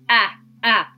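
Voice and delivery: short a sound "あ" said twice, crisp and clipped; phonics short a. Shown as a demonstration. Teacher showing the phonics sound あ, crisp and clear. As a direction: say "あ" like the a in apple. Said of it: "フォニックスで言うとあ".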